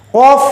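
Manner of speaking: teacher contrasting a heavy qaf with kaf; thick qaf. Shown as a demonstration. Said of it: "Ternyata isti'la".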